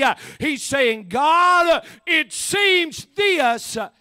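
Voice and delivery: loud, driving sermon delivery, hard on each phrase; full-force preaching. Real, not acted.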